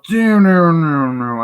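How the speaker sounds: high, loud imitated swoop falling in pitch; slow-motion flying sound. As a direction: voice a swooping flight effect.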